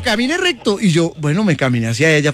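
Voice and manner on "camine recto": higher mock doctor voice; mock doctor giving orders, higher; imitating doctor. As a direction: play the bossy doctor, pitched up. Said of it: "Entonces me dijo".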